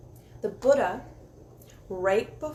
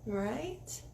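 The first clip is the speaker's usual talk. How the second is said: normal talking voice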